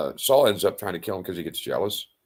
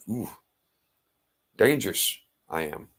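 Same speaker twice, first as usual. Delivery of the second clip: ordinary talk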